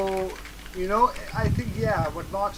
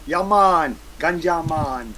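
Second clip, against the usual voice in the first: bad Jamaican accent; mock Jamaican ganja man; 7 dB louder, much slower than usual. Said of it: "That was the worst fucking Jamaican accent I think I've ever heard".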